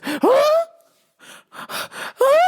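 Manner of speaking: high, exaggerated gasps of alarm; mock shocked gasp. Older lady alarmed at the men's restroom plan, mock gasping. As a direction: gasp as if scandalised.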